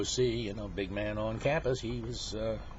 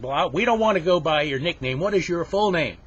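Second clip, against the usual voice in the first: loud, raised scolding voice; stern teacher; 7 semitones higher, 10 dB louder than usual. Acted. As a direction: press the pupil for a proper answer. Teacher scolds new pupil.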